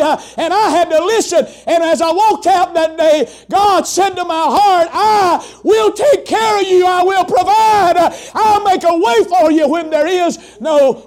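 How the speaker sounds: climbing, shouted preaching; preacher shouting God's promise to the congregation. Acted. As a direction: build to a ringing climax. Back to full-force preaching.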